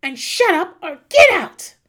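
high, slow, loud command; imitating Thomas throwing her out; mock angry order. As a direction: bark it out in mock fury. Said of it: "And he says".

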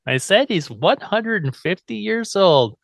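put-on patient, drawn-out girlish repetition; emphatic repeat. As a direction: spell out the age for a baffled listener. [name] repeats it to stunned professor.

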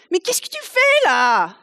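high, loud indignant French retort; indignant French outburst. Retold or imitated. Preacher voicing sailors scolding Jonah, indignant in French. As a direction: throw up your hands in French disbelief. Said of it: "when I get indignant, it comes out in French".

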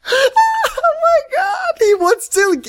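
high, slow laughing cry; laughing disbelief. Real, not acted. Cohost crying out in laughing disbelief at the plot. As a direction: crack up in shock.

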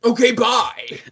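loud, high put-on farewell; curtly departing host. Host leaves the ghost hunters, loudly dismissive. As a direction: call out a theatrical goodbye. GM plays Arno leaving.

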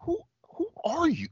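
high-pitched, baffled squeak; mock bewilderment. Punched at the door by a stranger, mock bewilderment.

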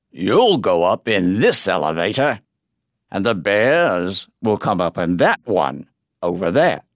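low rumbling giant voice; a big brute rumbling his words; giant rumble. Acted. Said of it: "the giant rumbled".

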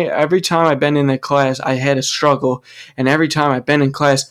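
normal talking voice

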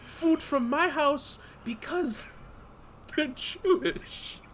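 put-on high squeaky mouse with a German accent, slow; mock German accent. Mouse pleading with the Burgermeister in a high mock German accent.

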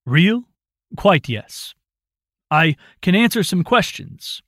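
brisk, a little impatient; brisk old man. A narrator's reading. Roland voiced.

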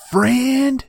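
very high, loud put-on voice; mock outraged shriek. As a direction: shriek the word as a scandalized question. Mom shrieks at word friend.

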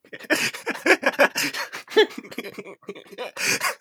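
high helpless giggling fit; giggles. Real, not acted. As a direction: giggle at your own joke. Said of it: "I've got the giggles".

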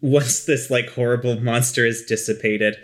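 usual speaking voice

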